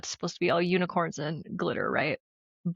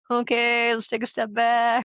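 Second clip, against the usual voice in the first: slightly raised, put-on voice of making oneself step back; mock composure; 4 semitones higher, 3 dB louder than usual. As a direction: pretend to collect yourself, a little higher. Acts out reluctantly swallowing one's ego.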